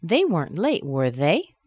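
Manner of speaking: "were they" rising at the end; really ask, lifting "they"; asking a question. Shown as a demonstration. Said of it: "I'm asking you a question".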